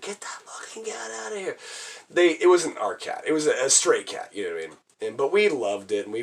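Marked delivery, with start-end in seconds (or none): 0.0-1.6 high, hushed mock yell; strained, half-whispered shout imitating a mother; scolding mom. Mom ordering a stray cat out, scolding.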